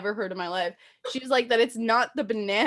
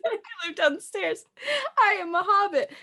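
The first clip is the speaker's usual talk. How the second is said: mocking imitation of her friend; 10 semitones higher than usual. Mocking her friend's complaint in a high silly voice. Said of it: "She said".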